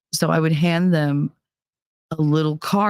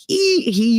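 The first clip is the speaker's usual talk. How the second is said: toothy grin giggle; 8 semitones higher than usual. Retold or imitated. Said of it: "you could see all his teeth".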